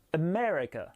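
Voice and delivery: "America" with a strong ME and weak other syllables; stress on second syllable. Shown as a demonstration. Teacher demonstrating word stress, "America" stressed on ME. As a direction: lean hard on ME in "America". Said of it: "c'est la deuxième syllabe qui est accentuée".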